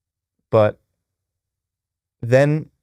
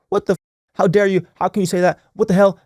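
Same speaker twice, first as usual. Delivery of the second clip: higher-pitched mock outrage; indignant outburst; 7 semitones higher, faster than usual. Husband imagines reacting to wife's comment, mock indignant. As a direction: act out taking offense, voice jumping up.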